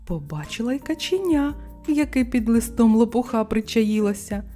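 plain reading voice

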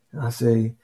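ordinary talk, nothing marked

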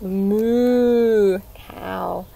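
very slow, stretching out moo; drawn-out moo cow. Shown as a demonstration. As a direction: linger on every word to show off the cow. Showing the cow to children.

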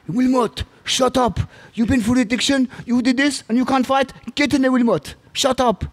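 high-pitched scolding orders; imitating a shouting coach. Coach ordering his doubting fighter into the ring, mock shouting. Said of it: "He shouted at me enough".

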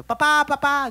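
put-on high excited child calling dad; a toddler running to the door. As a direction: son rushes out shouting for his father. Son runs out to father.

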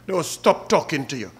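ordinary talk, nothing marked

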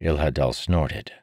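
ordinary delivery

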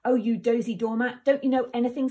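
loud scornful snarl; wicked fairy scolding, loud and scornful. A narrator's reading. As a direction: spit the insults at the wolves. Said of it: "snarled the fairy".

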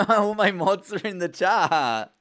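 high, loud, drawn-out cheer; thrilled, stretched-out shout; delighted squeal. Real, not acted. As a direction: burst out laughing with joy at seeing friends. Delighted to spot the mods.

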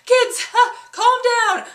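loud, high, slow call over the noise; teacher calling out. Retold or imitated. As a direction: call the kids to order from the desk. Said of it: "she looks up from her paperwork".

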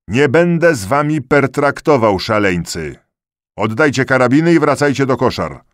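loud, high-pitched officer's command; shouted order. A narrator's reading. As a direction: order an unruly crowd back. Officer ordering rebellious soldiers back. (głośny, stanowczy rozkaz)

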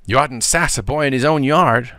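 put-on a touch of boyish argument; lightly voiced boy picking a quarrel; argumentative. A narrator's reading. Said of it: "said Tom argumentatively".

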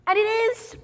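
boil over in complaint; grumpy outburst. Acted. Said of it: "He said".

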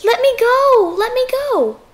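high, pleading squeal; squealed protest. A narrator's reading. Piglet grabbed by the fox, squealing. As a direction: squeal it high, struggling to get free. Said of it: "The piglet kicked and squealed".

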